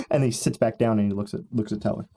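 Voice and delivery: normal talking voice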